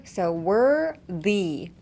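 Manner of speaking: "were the" drawn out slowly, "were" taken in, "the" spat out; slow demo of "were the". Shown as a demonstration. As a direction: pull in "were", then push "the" out. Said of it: "take in the were and then spit out the the".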